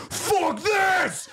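high, full-throated mock scream; retold scream. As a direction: belt it out like losing it in a cafe. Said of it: "I stood up and screamed".

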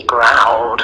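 low, growling delivery of the word; growled word. A narrator's reading. Narrator describing the lion to children, growled word.

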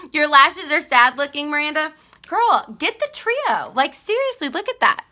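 high, loud teasing push to buy the Trio; playful urging. Real, not acted. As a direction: egg her on, playfully bossy.

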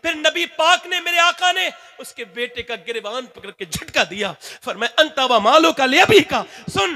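high, loud, shouted sermon building to a roar; fiery preaching. Acted. Preacher urging the crowd to honour their fathers, roaring. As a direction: thunder at the crowd like a street preacher. Preacher rises to full force.

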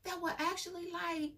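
high, frantic retold ranting; mock high-pitched yelling. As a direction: squeal it like an outraged boyfriend. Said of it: "screaming and hollering".

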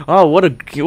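ordinary talk, nothing marked

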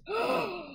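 sharp high gasp of shock; sudden waking gasp; startled gasp. Acted. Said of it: "he looks up at her".

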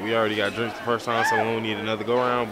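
usual speaking voice

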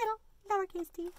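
small, soft "little lowercase t"; little-letter voice. Shown as a demonstration. Teacher shows the lowercase t, small quiet voice. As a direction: shrink the voice down for the little t.

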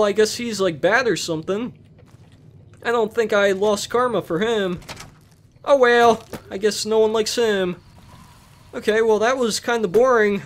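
ordinary talk, nothing marked

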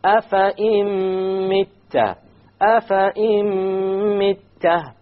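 Afa im mitta chanted high and very slow, noon dropped, meme doubled with nasal gunna; recited with gunna. Shown as a demonstration. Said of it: "and we add a gunna".

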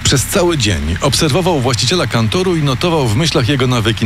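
ordinary delivery

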